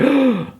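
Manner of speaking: high, sharp mock gasp; imitated shocked gasp. [name] watches her phone get smashed, mock shocked gasp. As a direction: act out a horrified intake of breath.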